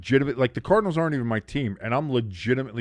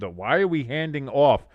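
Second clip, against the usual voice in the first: normal talking voice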